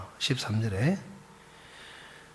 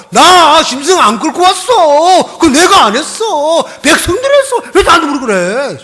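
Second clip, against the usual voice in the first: whiny denial; 12 semitones higher, 10 dB louder than usual. Acted. Preacher mimicking Saul denying blame, whining. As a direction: whine like a cornered child.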